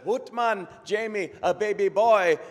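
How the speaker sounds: excited Scottish doctor, high and loud; Scottish brogue. Acted. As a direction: announce it joyfully in a hammed-up Scottish brogue. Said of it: "best Scottish brogue I can come up with".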